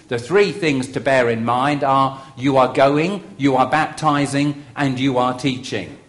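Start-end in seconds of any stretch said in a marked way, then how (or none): none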